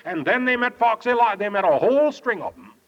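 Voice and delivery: normal talking voice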